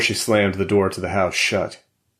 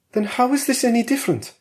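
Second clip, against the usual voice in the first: higher, quick, doubting wife; Valeria's voice; faster than usual. A narrator's reading. Wife challenges her husband's certainty, quick and higher.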